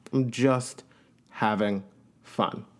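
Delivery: slowing and fading to near silence; drawn-out, fading. Shown as a demonstration. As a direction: let each word drop off. Repeats phrase, then draws it out.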